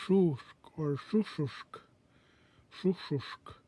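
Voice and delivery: "shushushk" said slowly, each sh with the tongue up and the teeth closed; O'odham double sh. Shown as a demonstration. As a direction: draw out both sh sounds in "shushushk". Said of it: "you can say".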